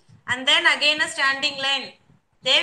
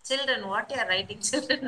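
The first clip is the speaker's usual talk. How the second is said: ordinary delivery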